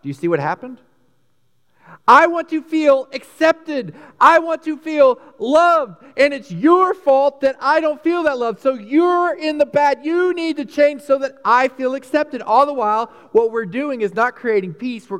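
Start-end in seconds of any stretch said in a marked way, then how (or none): 2.1-12.4 high, loud, slow whiny demand; needy demanding voice. Pretend. Person blaming others for lack of love, high loud whine. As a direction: plead and blame like a wounded child.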